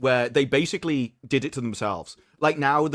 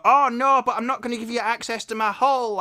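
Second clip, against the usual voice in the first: mocking; 9 semitones higher than usual. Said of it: "when people are".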